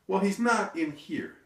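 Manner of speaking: high, slow, emphatic denial; very confident. Retold or imitated. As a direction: declare it with total assurance. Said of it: "she says this very confidently".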